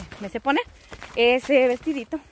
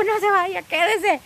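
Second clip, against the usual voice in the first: pleading; 7 semitones higher, 4 dB louder, slower than usual. Retold or imitated. Wanting the friend not to leave.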